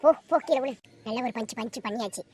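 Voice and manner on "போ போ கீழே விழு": loud, high gloating yell; triumphant shout. Acted. Wife gloating after punching her husband, loud. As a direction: crow over the fallen husband.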